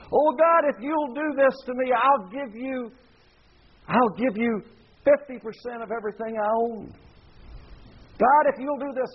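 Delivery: high, hammed-up pleading; desperate bargaining. Pagan worshipper offers God half of everything, mock begging. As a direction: beg and haggle in an acted plea.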